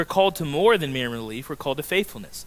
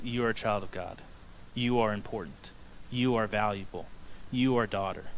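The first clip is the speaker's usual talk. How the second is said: softer, gentle voicing of Jesus; tender assurance; 5 semitones lower, 9 dB quieter than usual. Jesus speaking to the outcast woman, tender and soft. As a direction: speak it like a quiet blessing. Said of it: "and he says".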